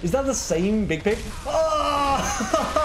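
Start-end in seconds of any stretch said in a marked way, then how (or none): 1.5-2.8 high-pitched yell; startled scream. Real, not acted. Player meeting Big Pig, startled high scream. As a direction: let out a high, startled cry.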